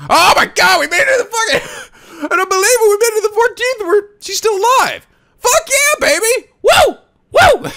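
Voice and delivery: overjoyed shouting through tears; crying and laughing with relief; relieved laughter. Real, not acted. Character survives, streamer celebrates.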